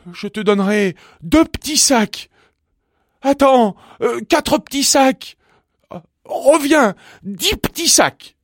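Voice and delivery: very high, loud, desperate offers; frantic bargaining. A narrator's reading. Stuck bumblebee calling the bee back with offers, frantic. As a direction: call after them urgently, pitch pushed way up.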